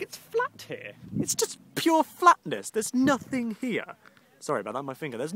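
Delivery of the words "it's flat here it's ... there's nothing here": high, drawn-out hammed-up complaint; incredulous high-pitched whine; exasperated protest. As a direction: stretch it out slowly, pitched way up. Mock outrage at flat landscape.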